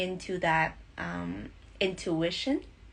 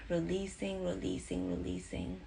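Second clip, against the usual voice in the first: fading release; 6 dB quieter than usual. Acted. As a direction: let the word fade out on the exhale. Meditation exhale cue.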